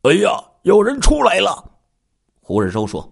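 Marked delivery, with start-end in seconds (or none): 0.0-1.7 high-pitched, mildly panicked warning; alarmed cry. A narrator's reading. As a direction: warn the others in sudden alarm, pitch jumping up. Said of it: "罗西惊慌地喊道". (略带惊慌的喊声)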